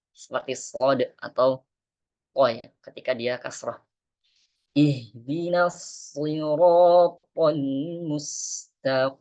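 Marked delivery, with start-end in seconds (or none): 4.7-9.2 Quran recitation. Shown as a demonstration. Teacher recites the Fatihah verse for the class. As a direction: recite slowly and calmly.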